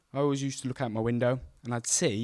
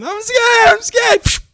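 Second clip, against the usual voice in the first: much higher and louder frightened cry; panicked scared shout; 13 dB louder, much slower than usual. Retold or imitated. Scared boy on monkey bars yelling in panic, high and loud. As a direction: shriek it in panic.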